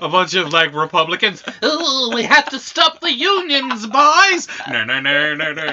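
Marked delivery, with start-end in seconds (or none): 1.6-4.5 higher, hammed-up rallying cry of a cartoon boss; hammed-up capitalist villain rallying the boys; mock villain boss. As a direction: play a cackling union-busting boss rallying his boys. Mock Republican villain quote.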